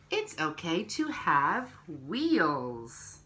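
slow, gentle picture-book reading; reading to children. Acted. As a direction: read each page to little ones, unhurried.

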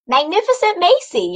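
bright high call of her name. A narrator's reading. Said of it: "yelled a voice".

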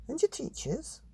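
high breathy mum voice; mum's voice. Acted. Mother explaining real animals, high airy voice. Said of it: "said Mum".

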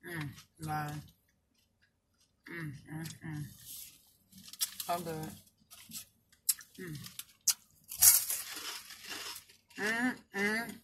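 chewing while talking; eating a taco; mouth full. Real, not acted. Seen 1: Food visible at lips, chewing while holding wrapped taco after bite.

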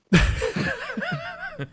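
high-pitched burst of giggling; high giggle. Real, not acted. Laughing at the lurking joke, high giggle.

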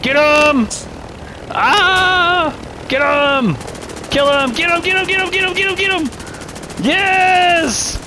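high frantic yells of get him; urging the gunner on, yelling; excited shouting. Real, not acted. Helicopter dogfight, yelling to shoot.

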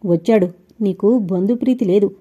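usual speaking voice

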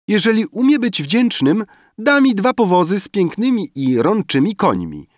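high, loud reveler's wish; eager demand. A narrator's reading. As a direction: pipe up high with your demand. Guests shout greedy wishes. (wysokie, głośne żądanie biesiadniczki)

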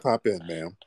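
usual speaking voice

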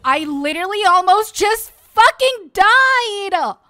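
high, drawn-out shout; panicked yelling. Real, not acted. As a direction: yell it slowly, shaken by a near death. Panics after near death.

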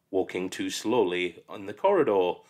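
ordinary delivery, nothing marked

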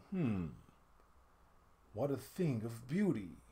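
low, soft, appreciative hum; admiring murmur. A narrator's reading. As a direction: savour it under your breath. Treasurer fingers cloth.

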